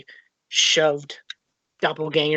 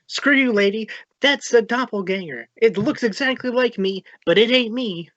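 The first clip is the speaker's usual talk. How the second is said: higher-pitched, defensive protest; indignant retort; 7 semitones higher than usual. Acted. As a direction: argue back at the priestess, mildly heated. Acted quarrel with the priestess.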